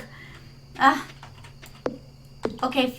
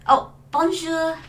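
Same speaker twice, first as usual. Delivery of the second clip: light French accent; 3 semitones higher, slower than usual. Shown as a demonstration. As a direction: greet them with a French lilt. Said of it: "I can do that accent a little bit".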